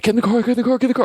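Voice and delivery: high frantic shout to get in the car; panicked, hurry everyone in; urgent yelling. Retold or imitated. Friends yelling to get in the car, high frantic shout. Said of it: "they're like".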